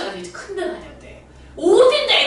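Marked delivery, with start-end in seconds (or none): 1.6-2.2 much higher, louder, slow furious questioning; worked-up shouting. Retold or imitated. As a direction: fire the questions at the student in a rage. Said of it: "그날 내가 너무 흥분을 해가지고". (흥분해서 높고 크게 다그치는 외침)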